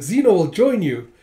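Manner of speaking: ally joining the rear guard; cheerful call. Acted. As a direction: announce it over the fighting. Calling out in battle.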